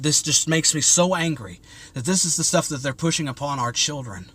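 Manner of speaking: ordinary talk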